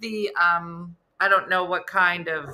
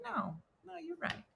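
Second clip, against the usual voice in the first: soft concession; 8 semitones higher, 18 dB quieter than usual. Retold or imitated. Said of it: "He was like".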